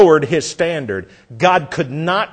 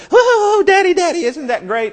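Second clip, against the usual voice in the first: put-on excited little girl; 14 semitones higher than usual. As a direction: squeal it like a thrilled small girl. Preacher voicing excited little girl.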